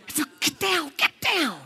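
hushed urgent. Acted. Disciples hiding from ghost.

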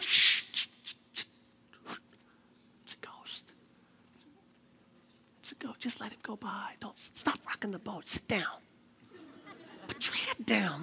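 frightened hushed whisper. Acted. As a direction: shush everyone and duck down. Disciples hiding, shushing.

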